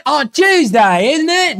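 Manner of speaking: put-on high, drawn-out London accent. Plugging a friend's London stream in a London accent.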